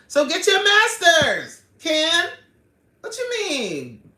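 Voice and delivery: high, loud, drawn-out scolding; exasperated urging. Real, not acted. Host urging viewer to get master's, exasperated shout. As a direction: holler it slowly at a friend making excuses.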